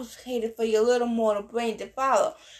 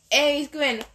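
usual speaking voice

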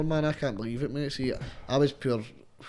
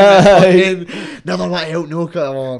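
slow, high, loud exasperated yell; hammed-up furious grumble; mock rant; 5 semitones higher, 15 dB louder, slower than usual. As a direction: rant like the world's against you.

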